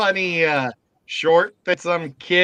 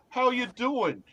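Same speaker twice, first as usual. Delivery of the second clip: New York greeting; 7 semitones higher, 5 dB quieter, slower than usual. Retold or imitated. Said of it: "Like in New York".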